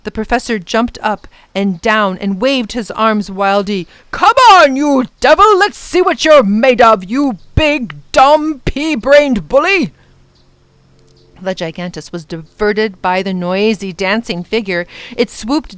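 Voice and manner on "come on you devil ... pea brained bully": loud, defiant jeering; taunting shout. Acted. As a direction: goad the monster to draw it off. Professor taunts the monster.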